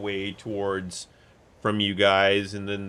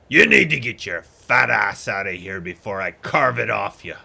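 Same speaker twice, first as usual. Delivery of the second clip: louder gruff threat from a half-orc; threatening thug; 3 semitones lower, 6 dB louder than usual. Acted. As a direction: menace him like a brute. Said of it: "half-orcs looks at you and says".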